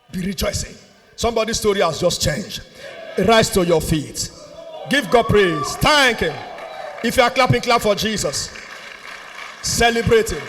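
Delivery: forceful preacher's call to worship; rally the congregation in short, punched commands; exhorting praise. Real, not acted.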